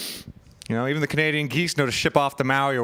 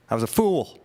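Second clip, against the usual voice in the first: normal talking voice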